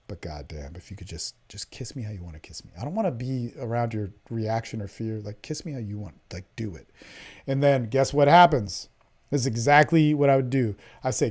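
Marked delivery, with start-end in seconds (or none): 0.1-6.9 much lower and softer, close and intimate; hushed seductive plea. Shown as a demonstration. Coach voicing his line to a hesitant woman, hushed and intimate. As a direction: murmur it like it's inches from her ear.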